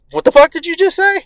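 high-pitched incredulous retort; mock indignant challenge. Dad challenges rude neighbor, mock indignant. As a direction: imitate an outraged dad. Dad's retold angry challenge.

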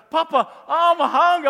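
put-on loud high child's call; loud, high little-boy voice; grandson calling.

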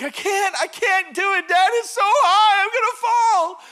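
high, loud mock wailing of a panicking boy; crying child. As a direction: imitate a kid bawling that he can't. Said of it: "he kinda starts crying".

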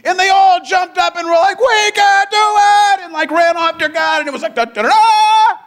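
high loud shouted rally cry; mock triumphant cheer. Israelites imagined charging off to God, hammed-up shouting. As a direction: ham up a victory charge. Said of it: "next sentence should be".

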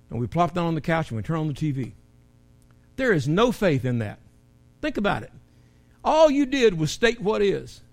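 ordinary talk

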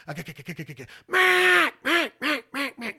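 loud high first quack, then falling away; duck hail call. Shown as a demonstration. Caller turning a passing flock, loud first note. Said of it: "That's what you're telling those ducks".

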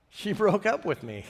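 normal talking voice

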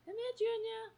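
very high, soft pleading call; cat-calling voice. Real, not acted. Owner begging her cat to come, very high. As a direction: plead with the cat, almost crying.

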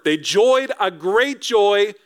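slow, gleeful, leaning on "joyed"; joyful emphasis. Shown as a demonstration. Preacher savouring the wise men's joy, gleeful. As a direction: relish the odd grammar. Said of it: "The grammar doesn't even really work".

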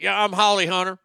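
loud, high mocking Southern impression; hammed-up side-mouth caricature; Holly Hunter. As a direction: talk out the side of the mouth, loudly. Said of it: "I talk outside of my mouth".

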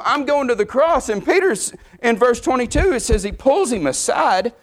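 high, ringing preacher's climb; fervent preaching. Acted. Preacher pressing the congregation on Peter's rebuke: fervent. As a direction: drive it home, lifted and urgent.

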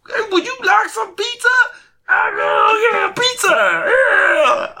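squeaky high excited voice; high silly voice. Retold or imitated. Retelling the pizza man's offer in a squeaky voice. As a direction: play it high and goofy. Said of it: "he was like".

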